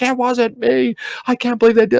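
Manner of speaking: high, pleading denial; desperate protest. Retold or imitated. Husband protesting to his wife that the friends did it, high and pleading. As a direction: beg her to believe it, voice up high.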